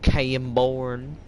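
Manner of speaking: drawled Western American; like a cowboy naming his town; American accent. Pretend. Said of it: "Sounds very Western".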